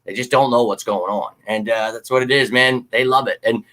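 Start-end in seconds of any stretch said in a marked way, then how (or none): none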